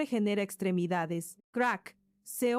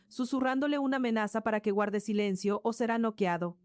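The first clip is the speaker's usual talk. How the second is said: usual speaking voice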